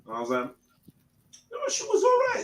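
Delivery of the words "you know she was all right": pitched way up, playful; high mock exclamation. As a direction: squeak it out high.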